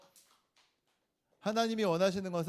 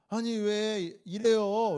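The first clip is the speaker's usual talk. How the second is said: higher, slow drawn-out protest; mock angry; 5 semitones higher, 3 dB louder, much slower than usual. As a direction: play an indignant onlooker, dragging it out. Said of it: "이렇게 화를 냈다".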